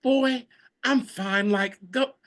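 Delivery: high-pitched impression of a fed-up mom; exasperated mother. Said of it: "she'll be like".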